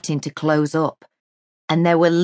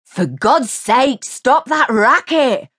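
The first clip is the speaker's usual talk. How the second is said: slow, loud annoyed bark; barking neighbour; 9 semitones higher, 4 dB louder, slower than usual. A narrator's reading. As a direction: bark at the noise. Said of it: "a hoarse voice barked".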